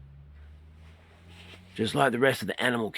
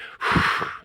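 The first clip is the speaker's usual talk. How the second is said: heavy sigh. Shown as a demonstration. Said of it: "So I'm out of breath, ready?".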